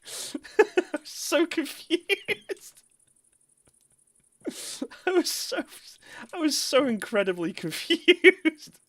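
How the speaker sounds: high, slow, giggling through the words; wheezy laughing confusion. Real, not acted. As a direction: barely get it out for laughing. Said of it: "That's why I started laughing".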